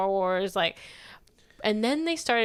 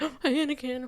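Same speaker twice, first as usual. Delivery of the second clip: mock fearful gasp; 4 semitones higher than usual. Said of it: "'Cause now he's like".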